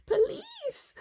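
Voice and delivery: very high squeaky cry; squeaked. A narrator's reading. Robbers squeaking as they flee. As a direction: squeak in fright. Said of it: "they squeaked".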